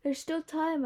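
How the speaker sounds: a lifted, determined insist. Acted. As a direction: press the point, don't back down. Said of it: "I insisted".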